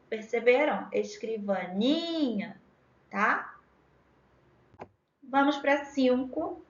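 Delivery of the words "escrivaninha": "Escrivaninha" slow, with the "ni" held long; stress on NI. Shown as a demonstration. Teacher checking students noticed, "Escrivaninha" called slowly, stressed on NI. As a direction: stretch the "ni" in "Escrivaninha". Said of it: "é o ni".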